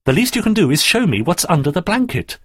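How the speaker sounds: high, exasperated telling-off; insistent demand. Acted. Daphne pressures Andrew to reveal his secret, insistent. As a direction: press the point forcefully. Girl scolds, then bargains insistently.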